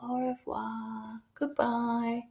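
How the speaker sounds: slow hushed au revoir to a sleeping doll; whispered au revoir. Acted. Child saying goodbye to a doll going to sleep. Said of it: "It's gonna go to sleep".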